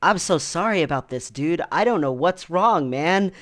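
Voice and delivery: retold bewildered apology, voice pushed up; confused, apologetic. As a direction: imitate a baffled guy apologizing profusely. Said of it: "he was so confused. He was like".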